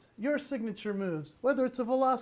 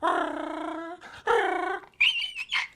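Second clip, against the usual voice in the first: much higher shrieking raptor cry; raptor screech; 10 semitones higher, 5 dB louder than usual. Pretend. Dad playing the raptor for his kids, high shriek. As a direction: let out a wild raptor shriek. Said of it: "going a hundred percent with the raptor".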